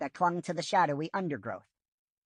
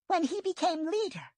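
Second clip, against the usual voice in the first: put-on higher, urgent old she-cat voice; Yellowfang's voice; 13 semitones higher than usual. A narrator's reading. Old she-cat offering help from Shadow Clan, higher voice. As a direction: voice the old medicine cat, hopeful.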